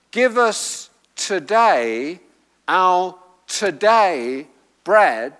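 slow, both "today"s leaned on; stress on the repeated TODAY. Shown as a demonstration. Preacher showing the redundancy in the request, stressed "today" twice. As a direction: spell out the doubled "today", word by word. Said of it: "is a redundancy".

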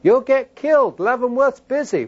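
high, urgent parental scolding, acted out; stern parental warning. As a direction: impress the danger on a small child. Parent warning small son, retold.

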